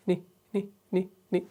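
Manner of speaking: "نی" with only a trace of the kasra, barely voiced; soft short "نی" tail of waqf bil-rawm; faint final vowel. Shown as a demonstration. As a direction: whisper the ending "نی" with a third of the zer. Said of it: "زور سے نہ پڑھیں".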